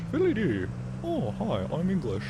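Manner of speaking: hammed-up English caricature; bad English accent. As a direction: put on a silly, badly done English accent. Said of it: "than I can an English one".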